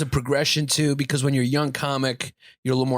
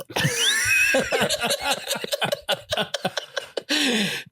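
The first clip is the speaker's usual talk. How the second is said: high, long laughter; big laugh; 8 semitones higher than usual. Real, not acted. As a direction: laugh hard at the joke. Laughing at the remembered joke.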